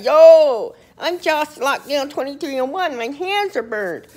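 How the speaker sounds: high, loud whiny inmate griping about burned hands; mock weakling complaint. Tough inmate mocking a weak cellmate, whiny and high. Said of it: "you be like".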